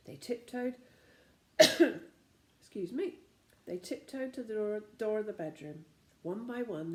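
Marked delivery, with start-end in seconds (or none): none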